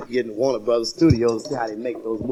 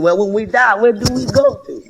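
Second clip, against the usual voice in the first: put-on kid's question; 7 semitones higher, 5 dB louder than usual. Kid asks dad a question.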